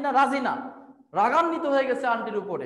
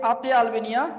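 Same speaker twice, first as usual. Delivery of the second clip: ordinary talk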